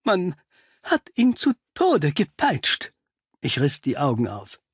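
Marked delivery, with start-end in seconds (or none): 0.0-2.9 halting, then much higher; horrified revelation. Acted. Pickley reveals how the caretaker died, horrified. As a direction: force the dreadful news out.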